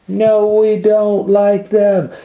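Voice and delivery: high, loud, slow refusal; children protesting. Acted.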